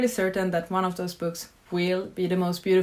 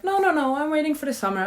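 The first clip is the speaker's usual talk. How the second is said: higher, breezy fob-off to mom; mock excuse; 7 semitones higher, faster than usual. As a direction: brush her off lightly, a bit sheepish. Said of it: "my excuse when my mom has asked me".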